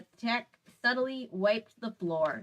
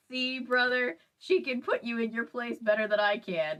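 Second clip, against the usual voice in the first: ordinary delivery, nothing marked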